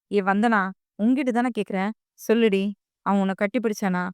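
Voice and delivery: plain reading voice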